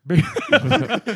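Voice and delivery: high burst of laughter; amused laugh. Real, not acted. Laughing at the mention of Baby Shark, amused laugh.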